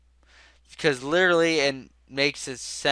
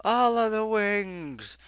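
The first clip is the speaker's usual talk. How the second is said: excited; 7 semitones higher, slower than usual. Retold or imitated. Oprah giveaway bit continued.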